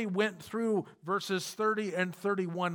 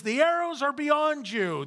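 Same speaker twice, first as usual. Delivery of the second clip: raised, higher call across a field; calling out the signal; 8 semitones higher, 5 dB louder than usual. Retold or imitated. Preacher voices Jonathan's signal call.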